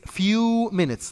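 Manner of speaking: "Few" drawn out and lifted; stress on Few. Shown as a demonstration. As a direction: lean on "Few", slowly. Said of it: "not just one minute".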